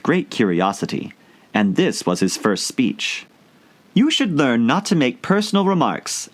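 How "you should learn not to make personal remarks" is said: put-on prim, higher scolding girl; a touch of severity, higher; severe. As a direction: lecture him primly. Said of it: "with some severity".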